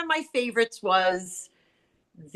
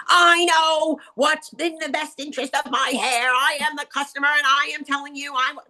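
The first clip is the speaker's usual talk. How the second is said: mock-indignant customer, pitched up and slowed; imitating a screaming client; 6 semitones higher than usual. Hairdresser imitating her angry client on the phone. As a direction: play the outraged client insisting she knows best. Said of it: "she screamed at me on the phone".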